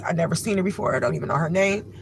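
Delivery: normal talking voice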